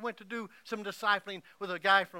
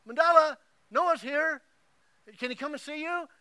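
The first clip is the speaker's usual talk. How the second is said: calling out; 8 semitones higher, 8 dB louder than usual. Retold or imitated. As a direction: call to her in the next room. Vanessa calls out to Mandela.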